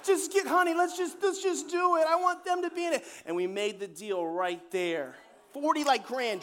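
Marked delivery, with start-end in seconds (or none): 0.0-3.0 much higher and louder, laughing through tears; moved lady. Retold or imitated. Moved lady pleads with her husband.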